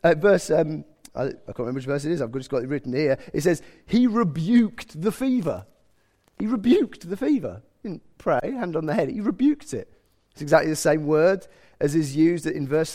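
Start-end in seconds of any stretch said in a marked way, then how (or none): 3.9-7.6 "rebuked" pitched high and drawn out; emphatic stress. Shown as a demonstration. Preacher contrasting this with gentle prayer, stressing "rebuked". 9.1-9.9 "rebuked" pitched high; emphatic stress. Shown as a demonstration. Preacher correcting the mild version, stressing "rebuked".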